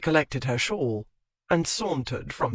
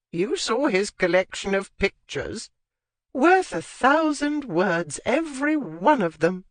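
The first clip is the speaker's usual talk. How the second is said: put-on old lady voice; 4 semitones higher than usual. A narrator's reading. Lady Withcote voiced high.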